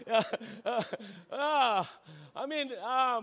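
ordinary talk

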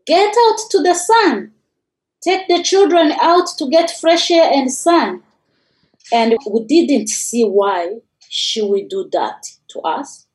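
usual speaking voice

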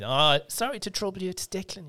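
high, lilting hammed-up Irish; hammed-up Irish accent. Trainee phoning to get a CT, hamming up Irish accent. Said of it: "began to ham it up a bit".

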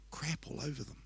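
whispered, voiceless retold quote; hushed admission. Son confessing to Dad what covered his overalls, whispering. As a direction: drop right down into a whisper.

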